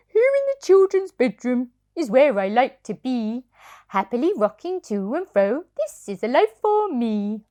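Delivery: a contented toy horse singing his joy; rocking horse voice. Acted. Parent reading to child as happy rocking horse. Sugar Lump speaks.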